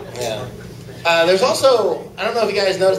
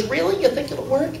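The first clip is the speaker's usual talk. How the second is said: eager bride; 9 semitones higher, 3 dB quieter than usual. Acted. Bride voiced eagerly in joke.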